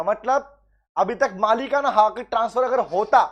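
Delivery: ordinary delivery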